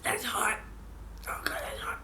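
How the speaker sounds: hot coffee, hushed whisper; whispered wince. Real, not acted. Vlogger sips hot coffee, whispered wince. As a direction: hiss it through a burnt mouth.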